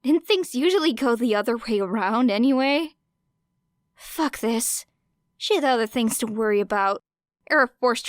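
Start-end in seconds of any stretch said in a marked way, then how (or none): none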